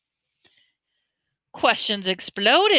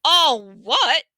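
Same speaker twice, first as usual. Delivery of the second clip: big booming giant's voice, high and loud; Hagrid thundering; 7 semitones higher, 7 dB louder than usual. A narrator's reading. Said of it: "Hagrid thundered".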